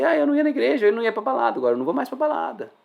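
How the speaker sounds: light, high mimicking voice. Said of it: "só fala assim".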